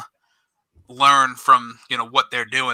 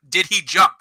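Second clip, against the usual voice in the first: higher-pitched, insistent demand without full volume; retold mild yell; 5 semitones higher than usual. Coach challenging the ref over a flag, mild retold yell. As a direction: re-enact pressing a ref for an answer. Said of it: "I'm, like, yelling at him".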